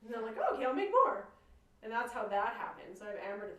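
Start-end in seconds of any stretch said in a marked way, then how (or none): 0.4-1.3 a much higher, louder burst of eager glee; eager excitement. Retold or imitated. To Amber, delighted she'll make more. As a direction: chirp it out, thrilled.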